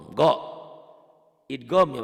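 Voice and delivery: ordinary talk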